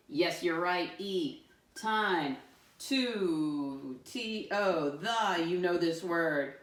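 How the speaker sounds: normal talking voice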